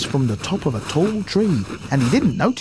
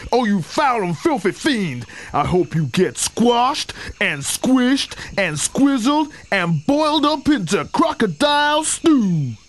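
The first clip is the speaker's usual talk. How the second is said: higher, rising outrage building to a high cry; outraged cry; 7 semitones higher than usual. A narrator's reading. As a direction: pile on the insults higher and higher. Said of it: "cried Chunky".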